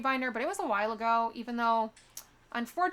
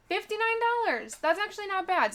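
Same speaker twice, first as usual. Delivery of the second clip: surprised; 8 semitones higher, 3 dB louder than usual. Real, not acted. Pleasantly surprised by flight price.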